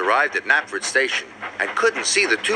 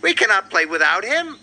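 raised, dismayed storybook wail; 6 semitones higher than usual. A narrator's reading. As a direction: band leader's mild wail, pitched up. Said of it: "he wailed".

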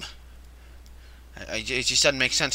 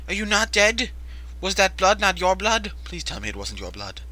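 ordinary talk

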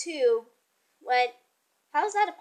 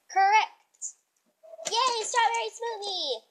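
high excited cheer; happy cheering; 8 semitones higher than usual. Real, not acted. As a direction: celebrate a win. Cheering correct guess.

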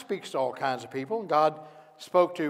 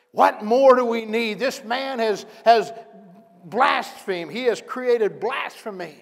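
outraged; 6 semitones higher, 6 dB louder than usual. Acted. Caiaphas outraged at Jesus.

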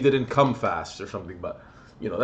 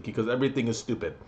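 ordinary talk, nothing marked

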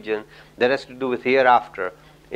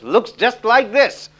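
high-pitched, slow, drawn-out boast; exuberant showing off; 8 semitones higher, 3 dB louder, much slower than usual. Retold or imitated. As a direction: imitate a tourist proudly showing off, stretching each word. Imitating the exuberant American showing off.

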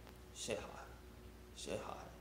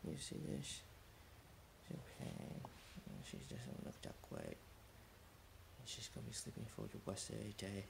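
whisper; 2 semitones lower, 17 dB quieter than usual. Real, not acted.

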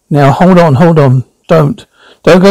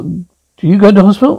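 ordinary talk

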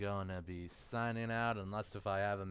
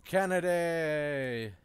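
drawn-out call; 4 semitones higher, 5 dB louder, slower than usual. Real, not acted.

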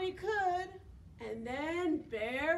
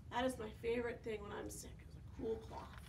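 quiet aside; 4 semitones lower, 7 dB quieter, much faster than usual. Real, not acted. Personal aside, fading.

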